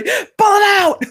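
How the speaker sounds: very high, loud, slow exclaim; excited squeal. Real, not acted. Host reacting to everybody in chat, excited squeal. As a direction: burst out in delight.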